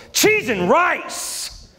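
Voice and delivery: high, slow, drawn-out fake swear; a dad swearing without swearing; mock curse. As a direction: stretch it like a cartoon curse. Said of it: "he cuts me off and I go".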